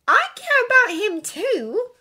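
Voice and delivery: put-on high, slow, emphatic girl's protest; arguing. A narrator's reading. Hermione matching Draco's claim, arguing. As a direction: stretch it out insistently. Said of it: "Hermione argued".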